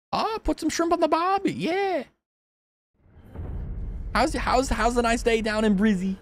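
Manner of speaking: high, hammed-up Australian accent; Aussie accent. Shown as a demonstration. Streamer showing off to pain Australian viewers, hammed-up Aussie accent. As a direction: put on a big, high-pitched Aussie accent. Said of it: "I have a good Aussie accent".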